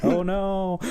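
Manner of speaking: high, far mock "oh no"; mock dismay. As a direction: fake distress. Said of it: "And I'm like".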